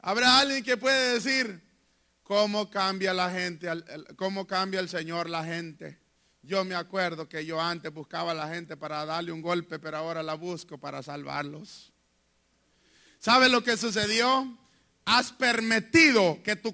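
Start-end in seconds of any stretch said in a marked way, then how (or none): 2.2-11.9 lower, softer voice of a humble convert; hushed, wondering testimony from a former brawler; quiet testimony. Retold or imitated. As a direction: speak it as a softly amazed convert.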